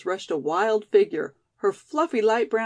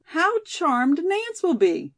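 high, drawn-out delighted exclamation; thrilled, stretched-out words; 8 semitones higher, slower than usual. A narrator's reading. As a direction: gush slowly with pleasure.

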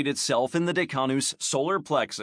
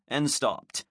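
plain reading voice

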